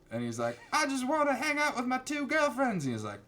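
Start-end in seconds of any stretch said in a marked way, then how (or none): 0.7-2.8 high-pitched mock teen voice; whiny teenage nephew. Nephew pleading with his uncle to hang out, whiny teen voice. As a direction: whine it way up high.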